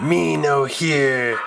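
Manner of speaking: slow, dragged-out brute voice; Hulk talk. Acted. Deaf Hulk taunts the police in his brute voice. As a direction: say it like a dim, hulking giant. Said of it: "was, said".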